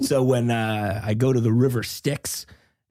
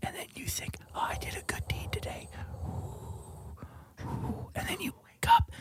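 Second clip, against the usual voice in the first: whisper; 3 semitones higher, 6 dB quieter than usual. Acted. Whispered story continues.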